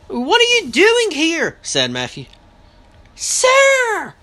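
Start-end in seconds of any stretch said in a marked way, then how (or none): 0.1-1.6 high, loud, slow demand; indignant demand. A narrator's reading. Boy confronting unwelcome Mister Lightwood, high and indignant. Matthew challenges the unwelcome visitor. 3.2-4.1 high, loud sharp rebuke; sharp correction. A narrator's reading. Mother correcting her son's manners, sharp and high. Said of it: "Mama said sharply".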